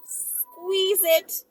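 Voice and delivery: normal talking voice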